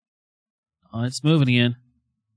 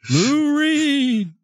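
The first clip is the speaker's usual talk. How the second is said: high, drawn-out spirit summoning; mock séance call; 10 semitones higher, much slower than usual. Calling [name]'s spirit with [name]'s question, mock séance call. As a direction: intone it slowly to the dead, hammed up.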